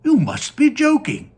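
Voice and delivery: high, loud disbelief; astonished grandpa. Acted. Grandpa Joe to Wonka, loud high astonishment. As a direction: gasp it out in disbelief.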